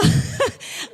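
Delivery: breathless frustrated laugh; exasperated laugh. Real, not acted. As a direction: laugh out of disbelief. Laughing mid-quote.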